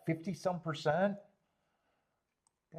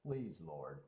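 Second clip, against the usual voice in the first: quiet mock prayer; 8 semitones lower, 12 dB quieter than usual. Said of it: "God, give me the patience".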